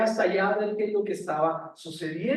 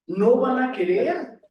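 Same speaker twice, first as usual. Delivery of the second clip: higher, louder and slow, hitting querer; emphatic no; 6 semitones higher, 7 dB louder, slower than usual. Acted. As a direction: drive the point home slowly and loudly. Preacher stressing Pharaoh's refusal.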